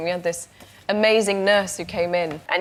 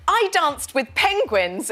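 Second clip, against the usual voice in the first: higher, slow and sing-song; chirpy Mary Poppins; 8 semitones higher, 3 dB louder, much slower than usual. Retold or imitated. Home from the set, telling her husband in a slow, chirpy, higher voice. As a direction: beam it like a nanny back from a musical. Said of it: "I'd come home from work and be like".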